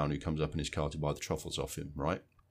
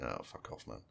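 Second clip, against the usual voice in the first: muttered dismissal; 9 semitones lower, 14 dB quieter than usual. Retold or imitated. Viewer reacting to bad accent.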